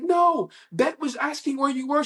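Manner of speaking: very high, exclaimed questioning; Barry. Retold or imitated. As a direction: call out in a high, put-on voice. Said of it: "He's like".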